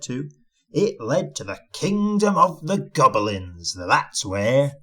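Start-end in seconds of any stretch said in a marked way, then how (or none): none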